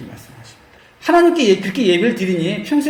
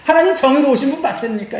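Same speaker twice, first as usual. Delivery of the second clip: higher-pitched acted protest; mock challenging; 7 semitones higher than usual. Priests disputing God's justice, acted challenge. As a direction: demand an answer, as if disputing. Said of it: "이렇게 따지는 겁니다".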